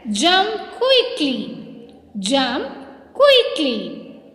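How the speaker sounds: slow, stretched classroom call; drawn-out command, falling at the end; called-out action command. Shown as a demonstration. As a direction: call each word out separately, drawn long. Teacher calling out action commands.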